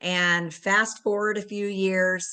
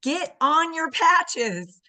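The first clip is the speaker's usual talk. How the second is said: friend's firm nudge; 8 semitones higher, 4 dB louder, much slower than usual. Retold or imitated. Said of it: "And she said".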